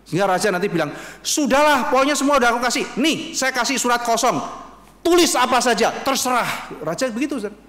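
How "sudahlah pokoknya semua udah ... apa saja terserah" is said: higher, louder king who gives in completely; king giving in. Retold or imitated. The king gives Esther a blank letter, in a higher, louder, exasperated voice. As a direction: throw up your hands as the indulgent king.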